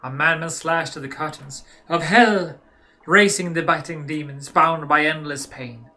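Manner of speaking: loud, dramatic poem in mock German accent; hammed-up German-accented recital, slowing at the end. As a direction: declaim it like a German gothic poet, German accent. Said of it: "now in German".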